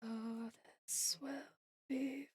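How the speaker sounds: softly whispered aside. Real, not acted. Streamer whispering a sly reply to chat, soft. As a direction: breathe it as a secret whisper.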